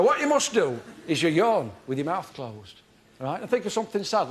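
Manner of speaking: ordinary talk, nothing marked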